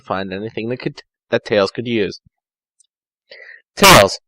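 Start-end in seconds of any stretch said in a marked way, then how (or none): none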